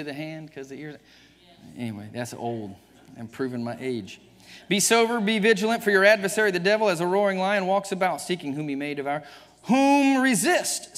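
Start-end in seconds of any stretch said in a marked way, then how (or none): 4.7-11.0 loud, higher fiery preaching; full force preaching. Acted. Preacher exhorting the congregation at full force. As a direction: thunder the verses at the church.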